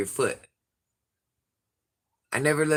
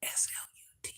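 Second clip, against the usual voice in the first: whispered spelling; 14 dB quieter, faster than usual. Real, not acted. Avoiding the word in front of her children, a spelled-out whisper. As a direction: spell it out in a hush.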